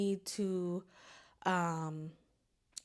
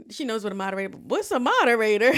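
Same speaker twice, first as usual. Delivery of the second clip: laughing; 9 dB louder, much faster than usual. Real, not acted. Laughing retelling of friend.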